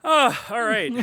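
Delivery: loud, high startled reaction; surprised. Real, not acted. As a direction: caught off guard, exclaiming. Reacting to grave suggestion.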